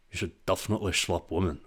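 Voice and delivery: a hint of Sean Connery, fast; Sean Connery impression. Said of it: "I can't even do an impression of him".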